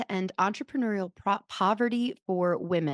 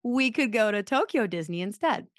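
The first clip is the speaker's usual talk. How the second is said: casual feeler; 5 semitones higher, 4 dB louder than usual. Retold or imitated. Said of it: "Just like".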